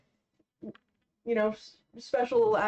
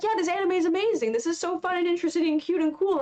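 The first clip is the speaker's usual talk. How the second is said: fast, higher gushing about the anime; gushing friends; 8 semitones higher, faster than usual. Retold or imitated. Imitating her group raving about Dungeon Meshi, fast and high. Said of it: "they're like".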